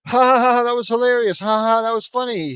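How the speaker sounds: high, loud fake "ha ha"; forced hysterical laughter. Friends praise Ed's impression, hammed-up laughing. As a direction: belt out mock laughing praise. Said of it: "We all laugh hysterically".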